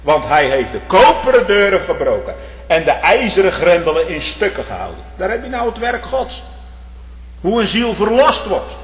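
loud, high fervent preaching; impassioned sermon climax; preaching at full force. Acted. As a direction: thunder it from the pulpit.

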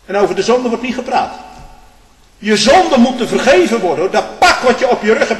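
fiery preacher climbing to a high, loud peak; preaching at full force. Acted. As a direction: build the sermon into a roar. Preacher rising again.

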